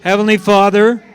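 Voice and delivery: ordinary talk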